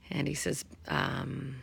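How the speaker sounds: ordinary talk, nothing marked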